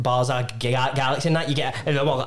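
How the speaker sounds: ordinary talk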